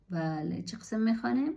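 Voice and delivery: ordinary talk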